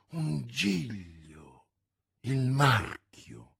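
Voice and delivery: lento e cupo, rivela il segreto; Athos cupo. A narrator's reading. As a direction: pronuncialo piano come una condanna. (Athos rivela il marchio, lento e grave)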